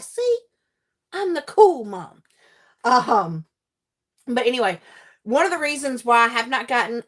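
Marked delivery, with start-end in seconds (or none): 0.0-2.2 high, gloating; smug boast. Retold or imitated. Mom to her kids, smug high boast. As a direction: show off to your kids. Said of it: "I'm like".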